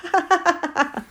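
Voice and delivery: high cackling bird laugh; jackdaw's laugh. Acted. Jackdaw laughing at the fallen bear, gleeful cackle.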